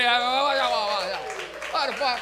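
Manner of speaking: long, stretched, loud rising yell; hype yell. Real, not acted. Host hyping the crowd, stretched loud yell. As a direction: drag it out to pump the crowd. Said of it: "Let me hear everybody".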